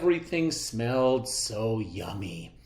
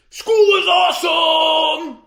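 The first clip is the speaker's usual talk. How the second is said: huge high excited shout; 12 dB louder than usual. A narrator's reading. Clark shouting to his class, thrilled. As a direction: bellow it out with joy. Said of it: "shouted Clark the Shark".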